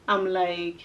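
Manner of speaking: normal talking voice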